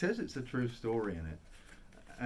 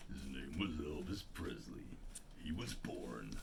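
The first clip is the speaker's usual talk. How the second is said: [name]; 8 semitones lower, 7 dB quieter than usual. Acted. Bass singer narrates the King's life, deep narrator impression. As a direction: drop right down into a deep bass tribute voice. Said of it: "That's [name]".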